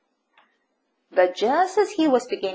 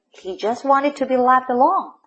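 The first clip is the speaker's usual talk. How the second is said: usual speaking voice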